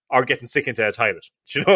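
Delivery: ordinary talk, nothing marked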